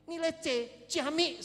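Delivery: loud, high mock praise; comic enthusiasm. Real, not acted. Preacher cheers a middling grade, loud comic enthusiasm. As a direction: proclaim it as if splendid.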